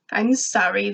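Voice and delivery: usual speaking voice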